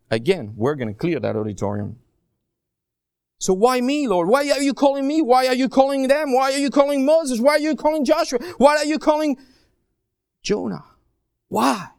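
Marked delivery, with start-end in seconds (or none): 3.4-11.9 higher and higher, whining why; a reluctant servant protesting; mock plaintive why me; pleading complaint to God.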